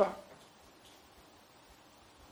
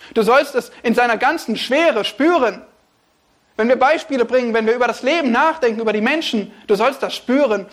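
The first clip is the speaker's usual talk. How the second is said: louder, urgent preaching with driving pace; preaching build; 4 semitones higher, 4 dB louder than usual. Real, not acted. Preacher urging listeners to feel life's weight, louder and driving. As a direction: press the congregation to feel it. Preacher pressing his point.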